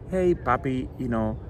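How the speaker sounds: usual speaking voice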